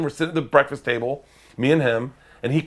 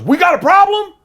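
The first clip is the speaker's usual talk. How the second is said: loud, high confrontational shout; aggressive challenge; 10 dB louder than usual. Retold or imitated. Pickup driver confronting honking couple, aggressive challenge. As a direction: challenge them, spoiling for a fight.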